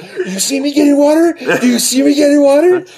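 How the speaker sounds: high, mock-incredulous dad voice. Son imitating his dad mocking him for getting water, high mock scorn. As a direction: throw it back at the kid like a scornful father.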